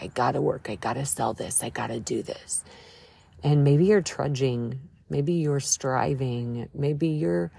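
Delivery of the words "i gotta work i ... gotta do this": "work" at normal level, then "sell" softer and "do this" whispered; fading to a whisper. Retold or imitated. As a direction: let the worried inner voice sink from speaking into a whisper. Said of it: "and you're like".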